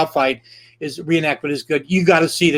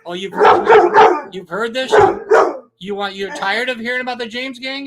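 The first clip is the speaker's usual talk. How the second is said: mock indignation; 8 semitones higher than usual. Host teasing a listener tired of the James Gang, mock offended. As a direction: play wounded and scandalized, teasing.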